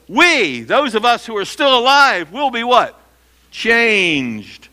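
high, loud rising call with a dropped answer; preacher drives the point home to his congregation; emphatic preaching. Real, not acted. Preacher to congregation, emphatic ringing call. Preacher stresses believers being changed.

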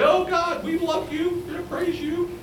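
high, pleading mock prayer of caught sinners; high desperate mock plea to God. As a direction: beg God like a caught hypocrite. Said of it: "maybe even these bad people say".

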